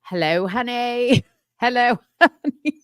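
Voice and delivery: high, drawn-out "honey" ending in "ay"; sing-song imitation breaking into giggles; show host's catchphrase. Co-host mimicking a podcast greeting, playful imitation. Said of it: "That's how she says it".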